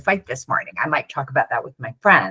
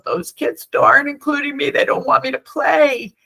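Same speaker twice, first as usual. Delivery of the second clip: high, lightly voiced sad little boy; a hint of a hurt small boy complaining; 9 semitones higher, 3 dB louder than usual. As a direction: play the left-out kid, higher. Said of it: "this little boy came over and said".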